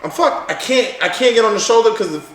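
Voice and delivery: high-pitched, rattled realisation; mock panic. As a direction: blurt it out as if trapped. Retells panic behind semi truck.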